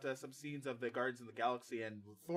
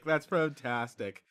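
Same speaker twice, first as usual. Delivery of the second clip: slow mock gush; 6 dB louder, much slower than usual. Shown as a demonstration.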